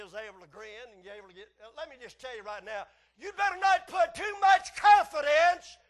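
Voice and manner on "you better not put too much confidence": high, full-voiced preacher's shout; forceful warning. Real, not acted. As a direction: thunder a warning to the congregation. Preacher warns congregation at full force.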